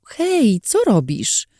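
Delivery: high startled "Hej"; sharp surprised protest. Acted. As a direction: protest at being grabbed. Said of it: "Podniosłam głos".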